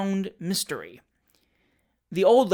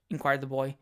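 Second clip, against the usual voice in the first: plain reading voice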